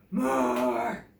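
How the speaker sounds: low, loud growled demand; growling dinosaur. A narrator's reading. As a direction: growl it from deep down. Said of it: "growls Grizzle".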